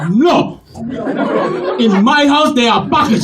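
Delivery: put-on insistent African-accented father; emphatic, African-accented correction; stern African father. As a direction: lay down the law in an African accent. African father insists on his word.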